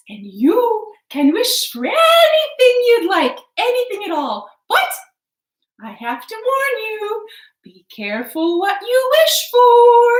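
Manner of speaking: a fairy's solemn caution, lilting; fairy warning. Acted. Fairy warns.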